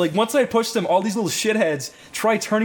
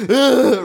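loud, high mock growl of effort; mock aggressive grunt; 7 semitones higher, 8 dB louder than usual. Kid grabbing the teller's t-shirt, mock aggressive. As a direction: imitate a kid lunging with a loud "ugh".

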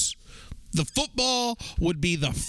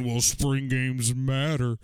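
slow, lower mocking voice; mocking football purist; 4 semitones lower, slower than usual. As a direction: drag it out like a stuffy critic. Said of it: "football guys out there mad at me saying".

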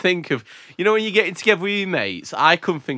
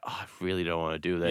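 lazy groan; 10 semitones lower, 12 dB quieter, much faster than usual. Retold or imitated. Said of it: "I'm just like".

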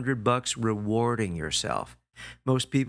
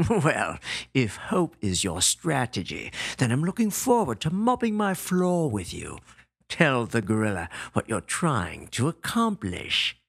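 sneering tough guy, mock sweet; mocking the chump's plan; sarcastic gorilla. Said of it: "he says sarcastically".

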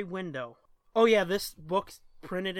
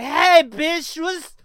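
put-on drunk sign-off; 11 semitones higher, 11 dB louder, slower than usual. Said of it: "it's like".

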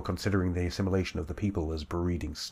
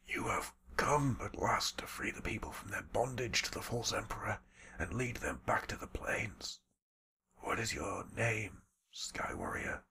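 hushed, breathy whisper full of wonder; an old man barely daring to believe; awed whispering old shaman; 4 semitones higher, 10 dB quieter than usual. Acted. Old shaman whispers in awe to his rescuer.

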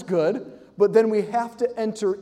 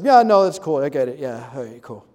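playing it cool; 6 semitones lower, 4 dB louder than usual. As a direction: act unbothered to hide the eagerness. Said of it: "if you don't wanna say it".

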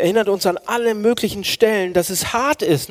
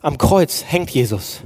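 usual speaking voice

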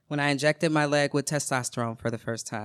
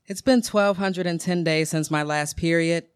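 ordinary delivery, nothing marked

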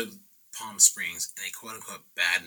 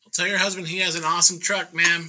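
high, loud, quick mock politeness; placating reply; 10 semitones higher, 6 dB louder than usual. Painter placates the angry truck owner, high and hurried. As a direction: rattle it off high and loud to appease her.

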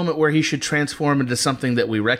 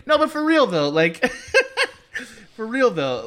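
laughing; 9 semitones higher than usual. Real, not acted. Laughing while talking.

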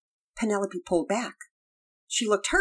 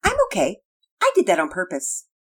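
put-on nonchalance; 6 dB louder than usual. A narrator's reading. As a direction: play it off as if nothing happened. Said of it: "in her most nonchalant voice she muttered".

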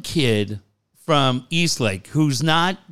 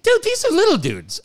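surprised realization; 14 semitones higher than usual. Retold or imitated. Realizing they are small kids.

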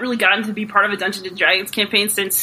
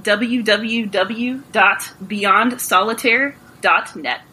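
slow, spelled-out web address; slowly; much slower than usual. Shown as a demonstration. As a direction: give it out slowly, piece by piece. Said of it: "one more time slowly".

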